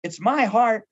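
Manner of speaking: mock defensive questioner; indignant objection. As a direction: push back as if offended. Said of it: "Sometimes someone will say".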